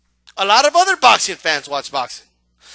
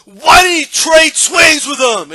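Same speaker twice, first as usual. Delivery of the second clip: loud, higher, indignant shouting; outraged fan; 3 semitones higher, 6 dB louder, slower than usual. Retold or imitated. Fan furious the winner dodged, loud indignant shout. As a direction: protest the result in outrage. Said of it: "the guy that goes".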